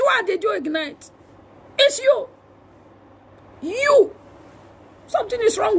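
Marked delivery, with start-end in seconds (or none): none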